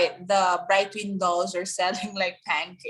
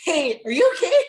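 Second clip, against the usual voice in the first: concerned fan; 4 semitones higher, 4 dB louder than usual. Retold or imitated. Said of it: "If I am one of the fans".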